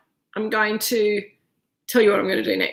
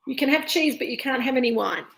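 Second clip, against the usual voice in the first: normal talking voice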